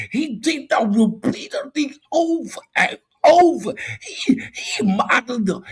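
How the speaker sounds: high, loud mocking caricature, stammering; mocking a fool. Debater mocking opponents' way of talking, loud high caricature. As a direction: mimic an opponent in a hammed-up, high-pitched holler. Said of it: "you sound like an illiterate fool".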